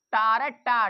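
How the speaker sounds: तारा and टा said loud, with the टा sound pushed out; sounding out the first syllable. Shown as a demonstration. Teacher showing the टा sound in टेबल, loud and clear. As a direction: hit टा hard and clearly. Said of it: "टेबल में काय का उच्चारण आ रहा है?".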